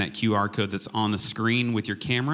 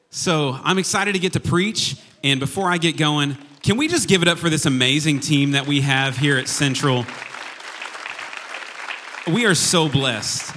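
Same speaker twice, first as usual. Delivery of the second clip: loud, high hype for the team; rally the room like a cheerleader; all-hands ceo; 5 semitones higher, 5 dB louder than usual. Real, not acted. Hyping up the worship team.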